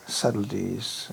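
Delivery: Subtleties said slowly, low and soft; stressing the word. Shown as a demonstration. Speaker savouring the godbrother's word, slow low Subtleties. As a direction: draw out Subtleties, low and quiet.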